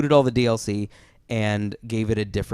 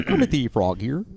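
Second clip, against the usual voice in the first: Kermit the Frog. Pretend. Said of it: "Brought to you by Kermit the Frog".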